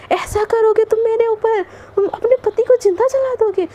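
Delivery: high-pitched acted protest; incredulous, play-acted indignation; mock outrage. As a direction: protest as if appalled, pitched well up. Acted husband protesting wife's threat.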